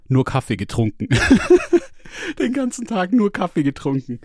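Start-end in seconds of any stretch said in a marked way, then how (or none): 1.1-1.8 sudden squeaky burst of laughter; loud high laugh at himself. Real, not acted. Laughs at own coffee slip. 2.4-4.2 high, giggly, through laughter; laughing. Real, not acted. Laughing at own coffee habit.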